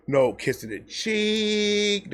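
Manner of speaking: ordinary talk, nothing marked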